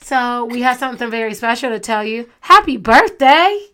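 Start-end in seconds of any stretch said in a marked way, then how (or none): 2.4-3.7 high, loud, slow happy birthday; excited birthday cheer. Real, not acted. Mom announces son's birthday.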